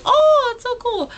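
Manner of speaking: high, loud, drawn-out awe; excited gush. Retold or imitated. Singer just petted the sign, excited gushing. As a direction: gush like a thrilled fan, swooping way up.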